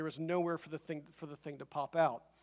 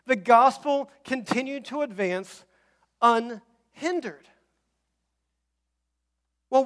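higher and louder, driving sermon cadence; preaching at full force; 6 semitones higher, 10 dB louder than usual. Acted. Pastor urging the congregation that the gospel advances, full-force preaching. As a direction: build the gospel point to a ringing climax. Preaching climax, rising intensity.

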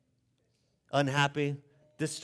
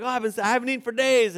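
hungry guest; 8 semitones higher, 6 dB louder, faster than usual. Retold or imitated. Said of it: "You're like".

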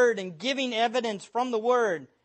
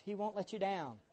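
much softer, mocking a weak sales pitch; mock soft sell; 2 semitones lower, 12 dB quieter than usual. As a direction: breathe it out like a gentle reassurance. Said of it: "They say things like this".

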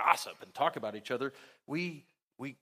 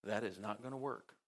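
softer, lower inner-voice version of "That is not gonna work"; private thought; 4 semitones lower, 7 dB quieter than usual. Shown as a demonstration. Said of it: "Did I think sometimes".

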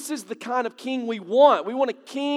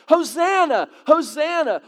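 higher, louder cry of Hosanna; crowd's cry; 7 semitones higher, 7 dB louder than usual. Retold or imitated. Preacher voices the Passover crowd, crying out louder and higher. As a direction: call it out like the crowd at the gate.

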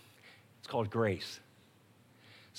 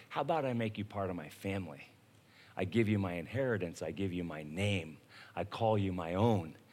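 much softer, lower, fast intimate offer; tender God's voice; 8 semitones lower, 7 dB quieter than usual. Acted. God inviting sinners into family, soft and lower. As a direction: offer it gently, close and low.

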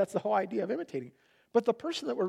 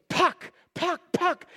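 put-on high, eager little-kid voice, a bit louder; eager toddler; 10 semitones higher, 4 dB louder, slower than usual. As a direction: chirp it like a small boy after his puck. Toddler asking for the puck.

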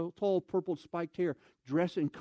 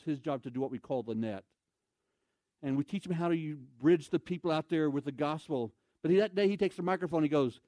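ordinary talk, nothing marked

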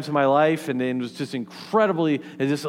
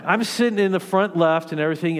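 normal talking voice

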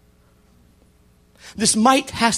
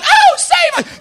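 loud, very high, drawn-out Southern wail; hammed-up Southern damsel shrieking; southern accent; 8 dB louder, much slower than usual. Lady begging to be rescued, mock Southern wail. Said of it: "while they were going".

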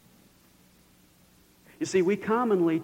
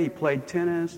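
ordinary talk, nothing marked

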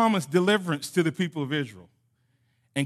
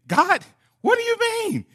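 baffled disbelief; 7 semitones higher than usual. Retold or imitated. Preacher recalls his first reading, baffled and high-pitched. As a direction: voice your own confused first reaction, pitched way up. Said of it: "I'm thinking".

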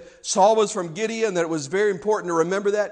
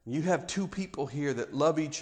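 tender, hushed sincerity; 4 semitones lower, 6 dB quieter than usual. Real, not acted. Softened sermon opening.

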